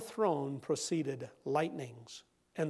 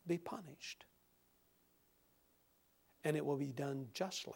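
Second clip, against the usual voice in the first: hushed sermon close; 8 dB quieter than usual. Acted. Preacher quietly concludes that sin will be punished, hushed.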